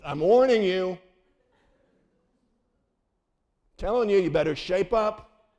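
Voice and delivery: loud stern warning from dad. Retold or imitated. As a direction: growl it like an angry father. Dad threatening from front seat.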